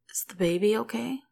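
slushy, numb-mouthed words; slurred. A narrator's reading. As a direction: slur it through a numb mouth. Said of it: "slushy in her mouth".

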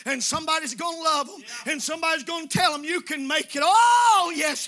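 loud, climbing preacher's call; full-force preaching. Real, not acted. Preacher calling to the hurting in the pews, full-force preaching. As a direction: preach it out to the congregation.

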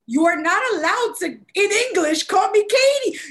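high, loud indignant tirade; mock scolding. Daughter forbids mom to English her name, mock outrage. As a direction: lay down the law, building to a shout. Acted quarrel with mother.

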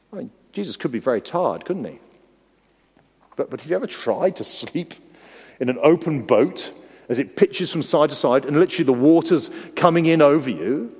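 ordinary talk

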